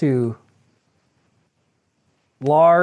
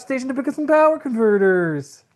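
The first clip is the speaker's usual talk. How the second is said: put-on higher, whiny farm boy voice; whiny Luke voice; 6 semitones higher than usual. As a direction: whine it like a frustrated teenager. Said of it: "whiny Luke voice".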